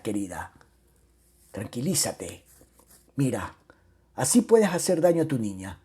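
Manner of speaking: soft, low, gentle reassurance; soothing. Acted. Husband tells crying Romilda to calm down, soothing. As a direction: hush her tenderly.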